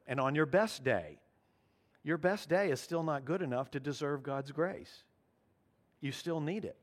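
ordinary talk

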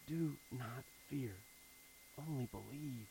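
quiet, calming voice of Jesus; tender reassurance. Acted. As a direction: hush it like comforting a scared man. Jesus speaks to a frightened father.

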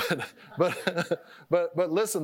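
laughing. Real, not acted. Laughing at his own rambling.

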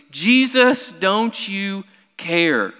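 high, loud "Jesus" then a slow drawn-out question; pleading rebuke. Retold or imitated. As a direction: stretch out the hurt accusation.

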